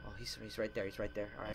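hushed and tense; barely whispering in fear; scared whisper. Real, not acted. Hiding from monster.

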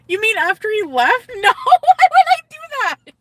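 very high, loud laughing shriek; laughing disbelief. Real, not acted. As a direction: protest through shrieking laughter. Laughing, scandalized at viewer's question.

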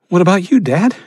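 a scared son pleading with his dad; boy's voice. Acted. Son interrupts his father, high and anxious.

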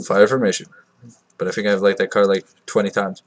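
ordinary delivery

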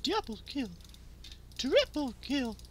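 high, playful announcer voice; high-pitched kill announcement; mock game announcer. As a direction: call the kills like a mock announcer.

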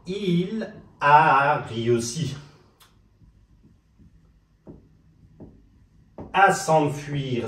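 drawn-out, syllable-by-syllable French; very slow model. Shown as a demonstration. Teacher repeating the example for learners to try, very slow and drawn out. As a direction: dictate it very slowly with long vowels.

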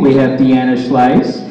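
ordinary delivery

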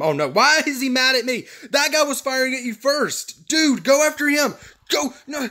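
high-pitched indignant pleading; agitated protest. Real, not acted. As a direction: protest frantically to the attacker. Enemy targets him in game.

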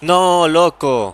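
long drawn-out anguished cry; frustrated shout. Real, not acted. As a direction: let it out, slow and loud. Goal chance against him.

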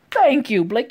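"Thank you" punched high and loud; emphatic cheer. Real, not acted. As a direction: belt out "Thank you" in exasperated approval. Louder second cheer for Blake.

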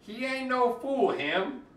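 ordinary delivery, nothing marked

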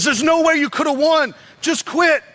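high, pressing taunt. Retold or imitated. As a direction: jeer at a beaten fighter to give up. Acting out a foe taunting the fighter.

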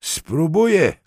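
loud, high call from afar; shouting back. A narrator's reading. Detective answers from inside the bathroom, shouting back. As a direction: shout it back from another room. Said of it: "krzyknął już z łazienki".

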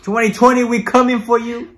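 loud, high hype cheer; hyped shout. Real, not acted. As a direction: shout it like a rally cry. Hyped cheer for new year.

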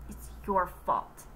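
slow, breathy unvoiced aside; Joy thinking it silently at Missus Drizzle; inner thought. A narrator's reading. Joy's silent blame, whispered slowly. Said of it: "she thought".

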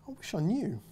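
put-on wistful little girl. Girl admits to the knight she forgot, wistful. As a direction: let the wish trail off in a child's voice. Girl's line, sadly unsure.

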